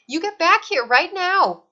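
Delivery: put-on furious yell at the escaping baby; angry command. A narrator's reading. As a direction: order her back at full voice. Boom commanding the fleeing baby.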